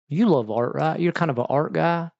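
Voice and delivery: put-on teasing country accent. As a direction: imitate a country guy sizing someone up. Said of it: "I give him a country accent".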